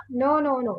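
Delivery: usual speaking voice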